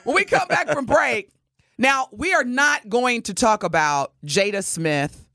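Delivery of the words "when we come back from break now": ordinary talk, nothing marked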